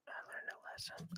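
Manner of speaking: whispered aside; secretive whispered aside. Real, not acted. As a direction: drop to a breathy whisper.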